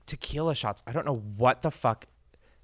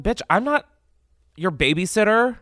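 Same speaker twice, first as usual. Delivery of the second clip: sassy comeback; 9 semitones higher, 4 dB louder than usual. Pretend. Mock comeback to the adults.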